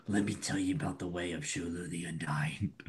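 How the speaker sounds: conspiratorial whisper in the ear. Acted. Monk confides his creed to the hero in a whisper. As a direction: draw him close and whisper the secret. Arm around shoulder, secret pitch.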